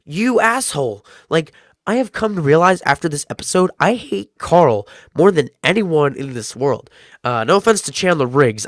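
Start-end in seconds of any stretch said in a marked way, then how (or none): 0.0-1.0 retold shocked reaction; shocked outburst. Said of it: "I was like". 3.8-6.9 mock hatred. Real, not acted. Ranting about hated character.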